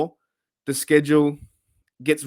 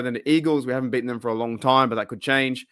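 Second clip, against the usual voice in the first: normal talking voice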